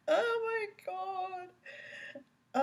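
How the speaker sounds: high squealing laugh into oh my God; cracking up, can barely get the words out; helpless laughter. Real, not acted. Laughing hard at the defendant questioning witnesses.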